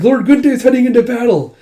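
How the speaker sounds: high, loud villager shout; imitated thrilled onlooker; excited outcry. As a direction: spread the news at the top of your voice. Villagers cry out as lord charges.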